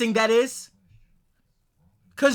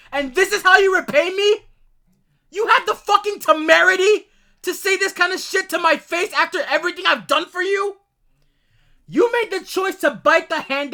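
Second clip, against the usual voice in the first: higher and louder, outraged; furious outburst; 7 semitones higher, 5 dB louder than usual. Real, not acted. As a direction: let the rage explode at him. Furious rant at ex-partner.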